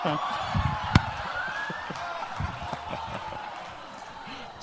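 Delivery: airy laugh up high; soft wheezing laugh; high breathy laugh. Real, not acted. Laughs at own joke.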